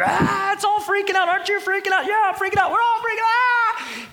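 hammed-up panic, very high and loud; mock hysterical yelling; freaking out. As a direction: imitate a frantic crowd losing it. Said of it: "all the people that are freaking out".

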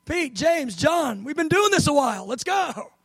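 high-pitched, impatient prodding; exasperated. Acted. Jesus calling Pete, James and John, exasperated. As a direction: urge them on, fed up. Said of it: "he is a little bit like, "Come on, man".

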